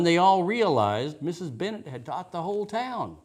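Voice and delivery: plain reading voice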